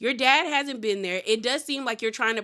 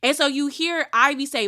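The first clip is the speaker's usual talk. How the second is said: usual speaking voice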